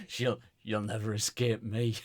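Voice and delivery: lightly voiced maniac; a hint of menacing stalker. Podcaster mocking a sinister aftershave slogan, lightly acted maniac. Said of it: "Like a maniac".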